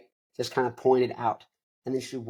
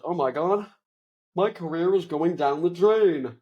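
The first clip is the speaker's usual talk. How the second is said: higher-pitched, mildly hammed-up despair; mock crying; 6 semitones higher, slower than usual. Actor lamenting his career going down the drain, mock wail. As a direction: wail it as a pretend sob, rising on the last cry. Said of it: "he goes outside and he's crying".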